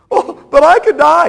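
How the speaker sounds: loud, high anxious question; mock worrier. Preacher voicing a worried listener, high and loud. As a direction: fret out the what-if. Worrier voiced in back and forth.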